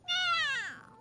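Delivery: high, drawn-out meow; high-pitched feline cry; cat meow. Acted. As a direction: yowl like a little cat. Cat girl meows when hurt.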